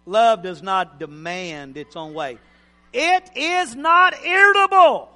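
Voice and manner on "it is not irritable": high-pitched preacher's holler; mock-irritable shout. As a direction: belt it out like someone who is irritable. Mock-irritable emphasis on scripture line.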